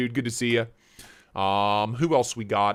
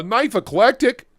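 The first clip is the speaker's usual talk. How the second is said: normal talking voice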